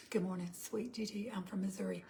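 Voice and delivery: soft whisper reading a viewer's greeting. Real, not acted. Reading out a viewer's greeting to herself in a whisper. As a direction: murmur the greeting as you read it.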